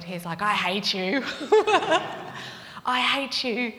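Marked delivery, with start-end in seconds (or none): 0.4-1.2 loud mock tantrum; angry child. Annoyed four-year-old to the aunt over cookies, angry. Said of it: "Like, just angry". 2.9-3.7 loud, raised mock tantrum; angry child. Annoyed four-year-old lashing out at the aunt, angry. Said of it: "Like, just angry".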